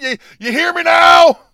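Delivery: loud high shout at the audience. Real, not acted. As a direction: yell it to drive the point home. Ranting, demanding viewers listen.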